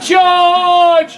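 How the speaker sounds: high, drawn-out battle cry. Acted. Antony on horseback to his army, crying out. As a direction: send the cavalry charging. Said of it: "he cries".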